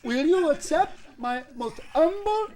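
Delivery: high-pitched mock Indian accent; put-on Indian accent. Group repeating the humble invitation, high mock Indian accent. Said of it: "Everybody repeats it".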